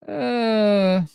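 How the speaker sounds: breaking into tears while laughing; real choked-up cry. Real, not acted. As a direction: voice cracks with emotion. Choking up over Vern.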